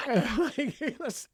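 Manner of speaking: usual speaking voice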